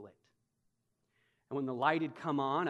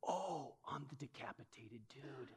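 dawning realization; 11 dB quieter than usual. Retold or imitated. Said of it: "And I was like".

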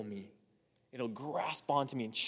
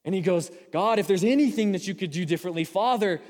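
higher, louder preacher climax; fiery preaching; 5 semitones higher, 5 dB louder than usual. Acted. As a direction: build the sermon to full force.

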